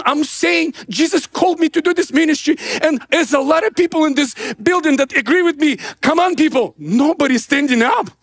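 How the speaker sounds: high-pitched, re-enacted rallying plea; pathetic pleading. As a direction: plead with a crowd that won't stand. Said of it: "It was so pathetic. I'm saying".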